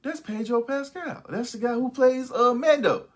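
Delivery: high, excited, half laughing; excited recognition. Retold or imitated. Friends watching, spotting Pedro Pascal, excited and high. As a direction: gasp as you spot the actor, then laugh. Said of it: "we was like".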